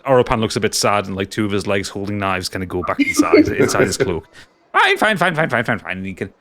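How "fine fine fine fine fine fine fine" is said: high, petulant giving in; sulky creature voice. Acted. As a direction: grudgingly give up. Shopkeeper sulks.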